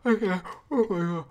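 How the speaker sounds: talking through a big yawn; words stretched by a loud yawn. Real, not acted. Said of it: "I'm gonna yawn".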